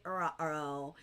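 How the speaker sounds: a small, low cartoon uh-oh; cartoonish uh-oh. Teacher repeating everybody's reaction, cartoonish ruh-roh. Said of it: "everybody's like".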